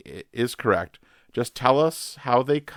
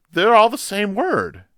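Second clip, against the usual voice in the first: surprised; 10 semitones higher, 5 dB louder than usual. Real, not acted. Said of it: "quiz reaction".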